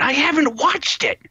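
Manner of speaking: high-pitched incredulous exclamation; emphatic exasperation. Real, not acted. Reviewer recommending an unseen original show, exasperated exclamation. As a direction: jump way up in pitch for the punchline. Punchline of damning verdict.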